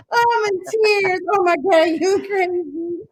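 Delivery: laughing to tears. Real, not acted.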